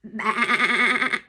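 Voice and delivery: loud bleating baa; sheep bleat. Shown as a demonstration. Teacher showing kids a sheep's sound, loud bleat. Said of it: "What makes a bah sound".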